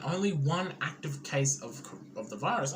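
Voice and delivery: ordinary delivery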